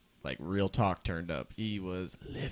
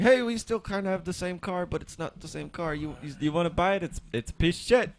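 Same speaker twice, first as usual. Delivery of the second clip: much higher, louder salesman voice; mock Chevy sales pitch; 9 semitones higher, 6 dB louder than usual. Chevy pitching its knockoff car, mock salesman. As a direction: play a shady car salesman. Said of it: "Chevy's attempt at being like".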